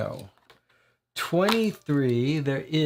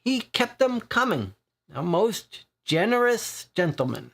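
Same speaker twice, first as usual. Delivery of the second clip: higher, teasing Irish dockside voice; grinning Irish regular ribbing the boys; jovial Irish accent with a grin; 9 semitones higher than usual. Acted. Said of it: "with an Irish accent".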